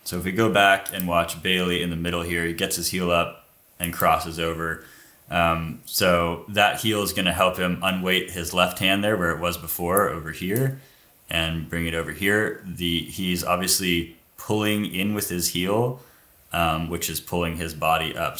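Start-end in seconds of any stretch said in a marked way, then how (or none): none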